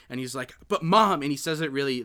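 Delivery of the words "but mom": a louder, higher, pleading "But Mom"; whiny grown son. Retold or imitated. Son whining at his mother for coffee, higher and louder. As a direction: beg your mother like a spoiled grown man. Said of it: "his delivery on this is great".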